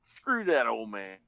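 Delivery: slow, drawn-out defiant declaration; fed-up defiance. Retold or imitated. Repo man retelling how he stood up to old man, slow defiance. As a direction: stretch each word out in retold defiance. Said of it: "went from being scared to being mad".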